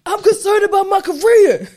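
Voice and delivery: high, loud, overblown mimicry; hammed-up loud imitation of an odd talker; Zeke impression. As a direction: belt it out mockingly, stretching the end. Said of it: "Why does he talk like that?".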